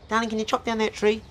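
high-pitched nagging wife impression. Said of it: "she's a real nagger".

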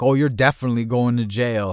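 loud and dead certain; retold emphatic certainty. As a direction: imitate a friend confirming the worst, loudly. Friend's emphatic reply.

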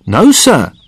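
high-pitched servant's reply; deferential caddy. A narrator's reading. Caddy answering his employer.

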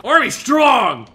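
slow, loud army chant; booming motto. Acted. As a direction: bellow it like a recruiter. Repeating motto, louder, slower.

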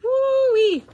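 high loud exclaimed woo-wee. A narrator's reading. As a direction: crow it out in delight. Reaction to spaghetti mess.

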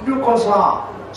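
normal talking voice

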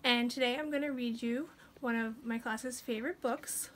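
usual speaking voice

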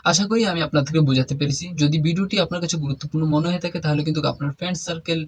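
usual speaking voice

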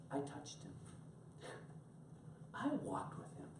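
soft, near-whispered awe; hushed remembrance. Retold or imitated. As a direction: let each memory out in a hush. Voices John pausing in awed remembrance.

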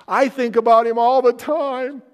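high, loud, wailing wife voice; upset young wife. Retold or imitated. Pastor imitating a crying wife in his office, high and loud. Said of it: "And she goes".

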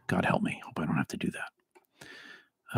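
whisper. Real, not acted. Dreading pulpit return.

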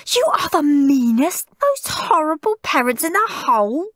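put-on high, furious child's outburst; high-pitched bratty rage; screamed tantrum. A narrator's reading. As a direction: scream it at the parents. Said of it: "screamed Henry".

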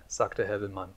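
ordinary delivery, nothing marked

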